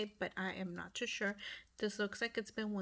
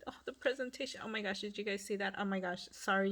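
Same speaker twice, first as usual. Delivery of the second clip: usual speaking voice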